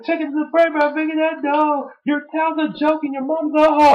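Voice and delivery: high sing-song mock rhyme; The Rock impression. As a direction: rattle off the insult rhyme up high. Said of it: "he was like".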